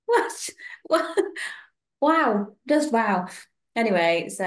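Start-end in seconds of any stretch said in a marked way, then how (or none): 0.1-1.2 high, stunned what; shocked what. Real, not acted. Reacting to the rude remark, high shocked yelp. 2.0-3.3 slow, louder deadpan wow; dry disbelief. Real, not acted. Summing up Chatterbox's rudeness, slow and deadpan.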